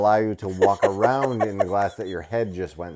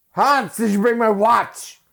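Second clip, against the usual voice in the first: high, loud and slow demand; mock shout; 13 semitones higher, 8 dB louder, slower than usual. Quoting a movie villain to his wife, high loud shout. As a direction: bellow it up high, dragging it out. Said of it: "and then he goes".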